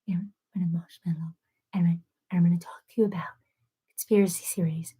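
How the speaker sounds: soft, breathy whisper into the mic; mock ASMR whisper. Mocking the man talking about conspiracy theories, hushed ASMR whisper. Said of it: "And then he's like".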